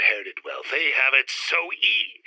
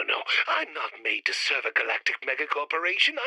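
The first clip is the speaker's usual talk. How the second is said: ordinary talk, nothing marked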